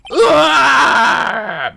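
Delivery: long, loud, high scream; bone-chilling scream. Acted. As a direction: let out a full, piercing acted scream. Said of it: "It's a bone-chilling scream".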